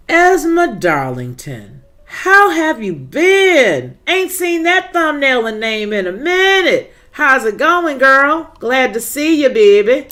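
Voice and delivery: light up and squeal the welcome back; greeting a returning viewer with high, loud delight; excited greeting. Real, not acted. Greeting a long-absent regular commenter.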